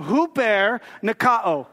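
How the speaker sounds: huper nikao slow and drawn out, hoo-PAIR nuh-KAH-oh; Greek pronunciation demo. Shown as a demonstration. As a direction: repeat huper nikao slowly for the group. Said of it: "One more time".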